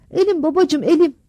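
high, loud cry of pain; a son crying to his father about his hand. A narrator's reading. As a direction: beg your father through the pain.

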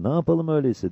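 high-pitched anxious question; Cabbar. A narrator's reading. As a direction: pitch it up, asking what to do. Cabbar asks, at a loss.

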